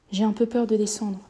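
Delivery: plain reading voice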